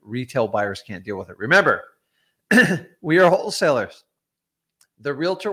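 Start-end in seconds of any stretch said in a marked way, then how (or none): 1.4-4.0 louder and higher, hammer home the point; emphatic reminder. Real, not acted. Host reminds viewers they are wholesalers, loud and emphatic. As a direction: press it on the viewers like a coach.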